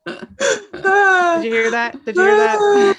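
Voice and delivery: high, loud, slow astonished question; surprised. Real, not acted. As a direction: call out at the startling noise. Reacting to birds in room.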